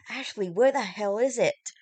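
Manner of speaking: angry demand. Acted. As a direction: demand it back furiously. Marshall demanding guitar back.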